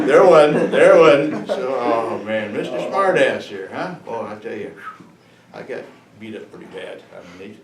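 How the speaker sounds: normal talking voice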